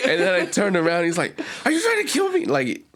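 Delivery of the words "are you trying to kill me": high panicked plea; frantic and high, as if in danger. Retold or imitated. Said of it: "but he was very frantic".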